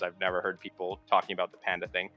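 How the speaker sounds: usual speaking voice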